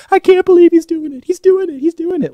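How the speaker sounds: very high, loud squealing lady voice; imitating a shrill excited stranger; shrieking weird lady. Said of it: "and she's like".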